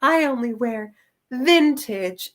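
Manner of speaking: put-on slow, affected fashion-girl voice; fashion-obsessed girl. Fashion girl announcing her style to others, affected and slow. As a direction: draw it out like a girl precious about her clothes. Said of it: "and she's like".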